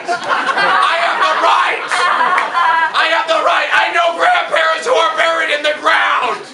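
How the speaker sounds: high, insistent yelled protest; indignant shout. Acted. Comic insists he may joke about the old, indignant shout. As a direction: insist on it at a yell.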